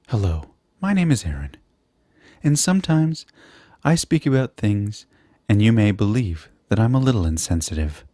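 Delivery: composed infomercial host introducing himself; measured disclaimer with lifted, drawn-apart phrases; mock infomercial. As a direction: play a mock-sincere TV spokesman, pausing between phrases. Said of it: "in, like, an infomercial?".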